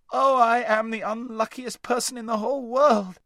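put-on high, loud terrified boy pleading; half scream it in terror; near-scream. A narrator's reading. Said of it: "he said, almost in a scream".

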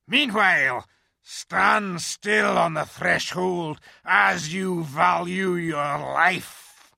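loud, high, growling beast shout; growling wolf. A narrator's reading. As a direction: bark it out like a guard wolf. Wolf figure voiced.

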